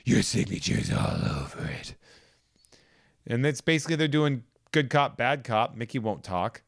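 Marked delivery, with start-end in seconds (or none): 0.1-1.9 low rasping whisper, Batman style; funny hushed Batman rasp; gravelly Batman voice. Retold or imitated. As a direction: growl it under your breath like the Bat. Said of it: "I'm gonna do it in that voice 'cause it's funnier".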